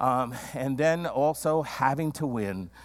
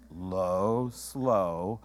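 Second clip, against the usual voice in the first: slow, lower, quieter; 7 semitones lower, 3 dB quieter than usual. Shown as a demonstration. Preacher modelling calm in conflict, slow and low. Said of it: "if you can remember low and slow".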